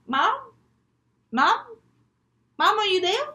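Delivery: tentative call with a rising end; searching, questioning "Mom?". Acted. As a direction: ask into an empty house, pitch sliding up. Child searches for missing mother.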